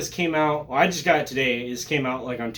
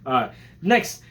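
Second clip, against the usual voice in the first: ordinary talk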